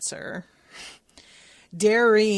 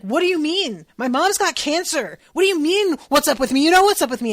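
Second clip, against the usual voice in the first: loud, high, hurt yelling back; retold furious shouting at a friend; angry outburst; 8 semitones higher, 12 dB louder than usual. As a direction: throw it back at him, furious. Nita snaps back at Kit.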